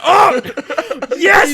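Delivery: high, loud, laughing cheer; gleeful yell. Retold or imitated. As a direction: yell it in triumph as if reliving it. Reliving glee at prank working.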